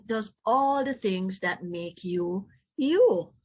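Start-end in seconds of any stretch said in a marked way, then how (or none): none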